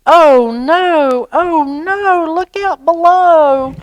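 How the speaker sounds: high, loud alarmed cries, slowing on look out below; alarmed cry. A narrator's reading. Warning everyone as the tree topples, loud and alarmed. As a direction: shout a warning as the tree falls.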